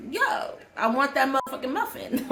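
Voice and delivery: a hint of a bee demanding the muffin; voicing a bee. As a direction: play the bee as a casual street tough. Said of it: "They're just like".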